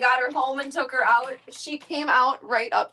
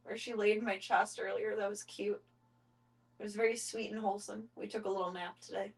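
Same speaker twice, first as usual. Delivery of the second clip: much softer, hushed talk; hushed so not to wake cat; 9 dB quieter than usual. Real, not acted. Talking hushed while cat snuggles on her, much softer. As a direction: keep it down, a cat is asleep on you.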